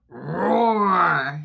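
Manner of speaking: put-on a big playful lion roar for the kids. Shown as a demonstration. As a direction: roar like a friendly lion, loud and long. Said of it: "Can you roar with me?".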